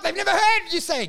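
ordinary delivery

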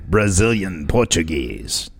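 put-on slow, low Brazilian Portuguese accent. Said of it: "Is that what it sounds like?".

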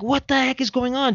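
high-pitched astonished outburst; shocked disbelief. Retold or imitated. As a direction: react to the brawl as if it can't be real. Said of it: "I'm like".

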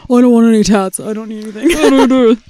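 loud put-on husband voice; hammed-up grumbling refusal; Mark impression. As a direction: mockingly imitate a protesting husband. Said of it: "Mark voice".

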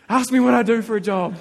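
amused pleading under the breath gone loud; laughing plea. Retold or imitated. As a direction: laugh while you beg him to ask. Silently begging driver to ask.

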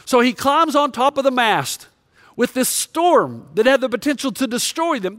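normal talking voice

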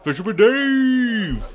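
slow, high, loud cheer; cheering shout. Acted. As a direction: belt his name like a crowd chant. Cheering the prankster.

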